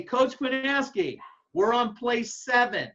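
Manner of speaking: mock yell. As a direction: call it out like a coded signal. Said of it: "If I say".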